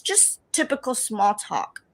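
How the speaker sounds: ordinary delivery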